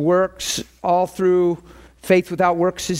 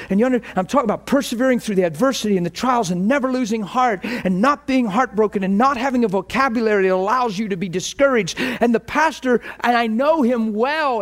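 ordinary talk, nothing marked

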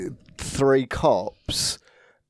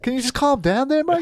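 put-on much higher, comic New Zealand accent; attempted New Zealand accent; 12 semitones higher than usual. Podcaster imitates a cop calming a demon, attempted New Zealand accent. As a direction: try a deadpan New Zealand cop, pitched up. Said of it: "the accent, but you know what I mean".

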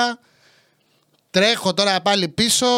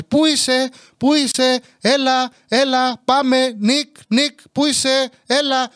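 high, repetitive calling; imitated shouting; 4 semitones higher, slower than usual. Mother who lost sight of son, mock shouting. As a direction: mimic mum yelling for you across the shop. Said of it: "και να φωνάζει".

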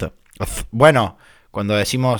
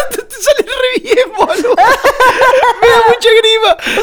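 voz agudísima entre carcajadas; muerto de risa; 5 dB louder than usual. Real, not acted. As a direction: habla ahogado de risa real. Se muere de risa con la imitación. (partido de risa)

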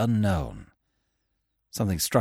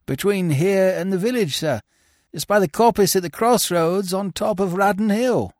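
lightly voiced, higher injured servant; a shaken groom answering his master; a hint of a hurt groom; 9 semitones higher than usual. A narrator's reading. Groom character voice.

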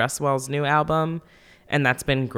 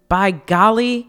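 put-on wholesome 1950s kid; 6 semitones higher, 7 dB louder, slower than usual. Gushing over [name] album.

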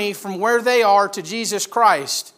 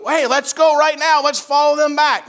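loud, high, urgent call to go; eager rallying call; 7 semitones higher, 6 dB louder than usual. Acted. Lord imagined answering messengers at once, eager rallying call. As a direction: raise it like rallying everyone to set off. Eager reply he didn't give.

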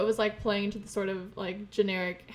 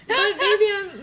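laughing; 10 semitones higher, 6 dB louder, slower than usual. Real, not acted. Laughing at own idea.

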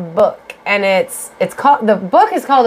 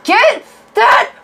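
mock outraged yell; 12 semitones higher than usual. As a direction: yell at the book like it wronged you. Mock rage at the author.

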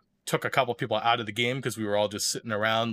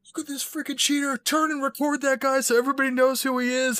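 high, put-on whiny voice calling him a cheater; mock angry accuser; 3 dB louder than usual. Player points him out to the cameraman, high mocking voice. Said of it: "then he's like".

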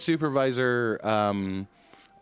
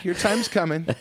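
ordinary talk, nothing marked

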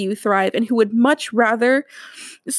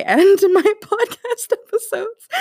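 high, cracking voice, half laugh half sob; real tears breaking into a laugh; laughing through tears; 11 semitones higher, 4 dB louder than usual. Real, not acted. Host losing track of her sign-off, laughing through tears. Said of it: "I'm so emotional".

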